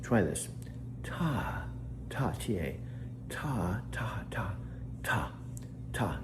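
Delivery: Ta repeated slowly, some whispered; coaching syllables. Shown as a demonstration. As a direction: drill the syllable ta for a pupil. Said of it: "coaching her how to say Takako".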